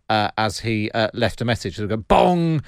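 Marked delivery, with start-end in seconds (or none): 2.0-2.6 loud, high bong imitating Big Ben. Host mimics lawyer's answerphone chimes, Big Ben bong. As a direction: boom out a mock clock chime. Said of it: "used to have Big Ben bonging".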